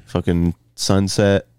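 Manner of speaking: ordinary talk, nothing marked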